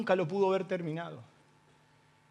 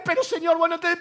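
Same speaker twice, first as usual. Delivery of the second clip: raised protest; 7 semitones higher, 4 dB louder, faster than usual. Retold or imitated.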